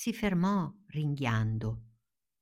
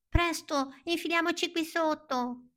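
much higher, light scarecrow voice; 14 semitones higher than usual. Acted. Scarecrow urging friends to hide, high and hurried. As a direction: pipe it up like a straw man.

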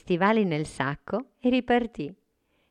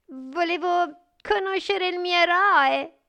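trembling voice; 14 semitones higher, 5 dB louder than usual. Acted. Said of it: "rispose con voce tremante".

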